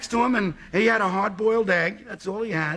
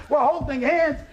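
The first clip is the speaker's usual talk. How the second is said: usual speaking voice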